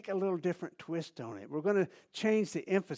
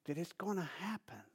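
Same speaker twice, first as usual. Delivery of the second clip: whisper; 9 dB quieter than usual. Real, not acted. Sermon close, hushed faith.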